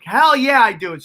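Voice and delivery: high, loud hell yeah; eager yes. Retold or imitated. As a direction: jump at the offer. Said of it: "I'm like".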